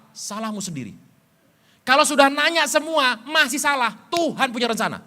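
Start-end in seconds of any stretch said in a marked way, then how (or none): 1.9-5.0 high, loud preacher's call; preaching at full force. Acted. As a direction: drive the point home to the church, loud and high. Preaching climax.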